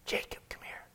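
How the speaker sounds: hushed secret whisper. Retold or imitated. Mother secretly calling Jacob over, whispering.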